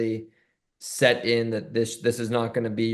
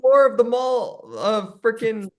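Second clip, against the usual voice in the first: incredulous; 12 semitones higher, 3 dB louder than usual. Real, not acted. Incredulous rant about car keys.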